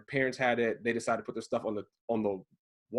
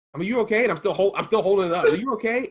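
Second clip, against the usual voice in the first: higher, louder worried blurting; alarmed concern; 6 semitones higher, 6 dB louder, faster than usual. Retold or imitated. Husband holding the box checks on hurt wife, alarmed. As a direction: call out in acted panic, hands full.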